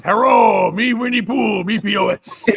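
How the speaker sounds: put-on high, loud caricatured Chinese accent; mock Chinese accent. Host mocking China's leader as Winnie Pooh, mock Chinese accent. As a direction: announce it in a hammed-up fake Chinese accent. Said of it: "makes a good point when he says".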